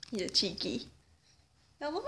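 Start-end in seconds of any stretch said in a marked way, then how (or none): none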